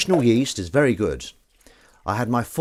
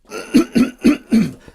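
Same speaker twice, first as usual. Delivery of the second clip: loud cough; 10 dB louder than usual. Real, not acted. Coughing on livestream.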